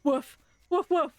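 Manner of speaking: high imitated woofs; timid dog woof. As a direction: woof like a dog hiding behind its owner. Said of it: "Or she'd hide behind us going".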